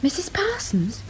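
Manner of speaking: whispered in alarm; surprised hushed whisper; startled whisper. Acted. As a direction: breathe the name in shock. Wife startled by news.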